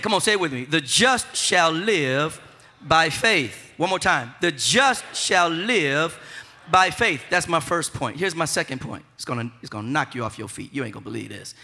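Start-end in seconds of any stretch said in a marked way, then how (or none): 0.6-7.2 The just shall live, very slow, a pause, then by faith; slow call and response. Shown as a demonstration. Preacher leading the congregation in slow recitation. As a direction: lead the church through it slowly. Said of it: "Say it like I'm saying it".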